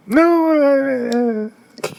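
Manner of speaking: high squeaky stammer, played up; mock panicked stammer. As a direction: squeal and stumble as if caught out.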